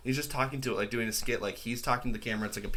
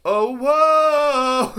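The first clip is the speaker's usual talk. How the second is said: very high, loud drawn-out whoa; viral whoa; 14 semitones higher, 13 dB louder than usual. Retold or imitated. Quoting a Wicked clip, shrieked high and loud. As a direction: belt a squealing whoa. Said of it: "he'll just go".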